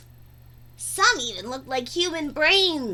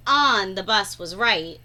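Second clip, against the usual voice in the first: plain reading voice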